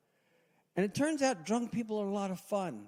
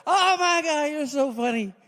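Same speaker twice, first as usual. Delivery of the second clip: put-on gushing drunk; 9 semitones higher, 5 dB louder than usual.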